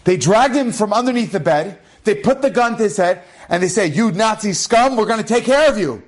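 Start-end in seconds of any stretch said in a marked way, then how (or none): 3.9-6.0 higher, menacing soldier threat; threatening. Retold or imitated. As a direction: threaten him with the gun at his head. Soldiers threaten him at gunpoint.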